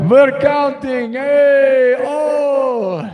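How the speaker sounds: very high, drawn-out vampire crowing; hammed-up vampire count. Vampire count tallying goth babes, high hammed-up accent. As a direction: call it out as a puppet vampire count. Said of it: "the count".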